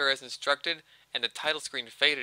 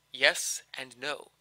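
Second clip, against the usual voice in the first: plain reading voice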